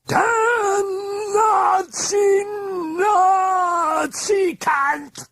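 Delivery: very high, slow, shrieked curses; hoarse mad shriek. Acted. The possessed girl shrieking curses from the bedroom, hoarse and mad. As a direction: screech it out like a mad thing. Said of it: "shrieked the hoarse, mad voice".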